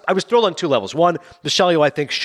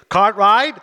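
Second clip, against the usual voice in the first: higher, louder call of "Cartwright"; mock shout; 7 semitones higher, 5 dB louder than usual. Fans quoting Seinfeld, calling out louder and higher.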